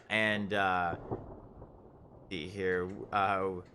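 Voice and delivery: normal talking voice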